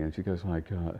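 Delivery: ordinary talk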